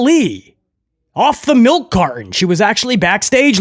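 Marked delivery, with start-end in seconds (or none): none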